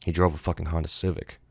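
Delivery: ordinary delivery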